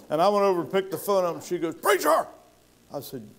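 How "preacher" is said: excited call. Retold or imitated. Mert excited on phone.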